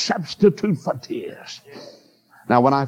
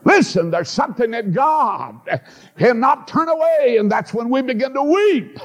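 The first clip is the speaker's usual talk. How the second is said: high, ringing shout about weeping; preaching shout; 9 semitones higher than usual. Real, not acted. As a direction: lift it high and cry it out. Preaching climax.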